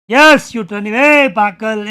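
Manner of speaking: loud jeering yell; loud slow jeer at the fool; mocking shout. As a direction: taunt him at full voice. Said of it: "આનંદ થઈને".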